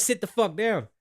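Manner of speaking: ordinary talk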